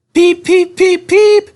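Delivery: high, loud repeated whistle; impatient whistling. A narrator's reading. Edward whistling for the missing guard, high and loud. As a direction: toot over and over, impatient.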